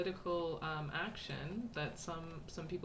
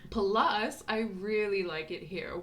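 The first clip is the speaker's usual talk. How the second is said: chirpy, higher and slower, reading aloud; bubbly postcard voice; 4 semitones higher, 5 dB louder, slower than usual. Acted. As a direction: read it like an excited girl writing home. Reads postcard in girl's voice.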